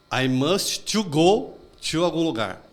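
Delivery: put-on slow, careful Brazilian-accented English, a learner's error; imitating his old mistake. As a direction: slowly sound out a beginner's broken English in a Brazilian accent. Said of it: "E eu falava".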